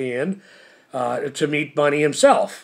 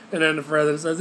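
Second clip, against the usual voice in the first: ordinary delivery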